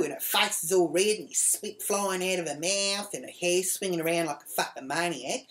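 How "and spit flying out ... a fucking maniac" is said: ordinary delivery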